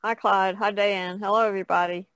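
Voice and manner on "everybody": ordinary talk, nothing marked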